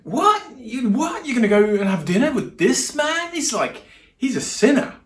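high, loud, scandalised crowd voice; mock shock. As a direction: squeal in hammed-up disbelief. Acts out scandalised crowd's reaction.

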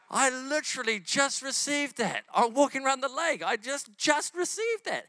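high, drawn-out amazed outburst; retold amazement. Telling a friend he just received that, amazed.

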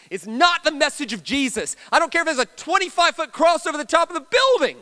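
loud, high, full-force declaration; vehement preaching. Real, not acted. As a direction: thunder the rebuttal to the congregation. Preacher rejects a false message emphatically.

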